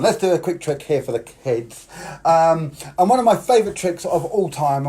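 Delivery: ordinary talk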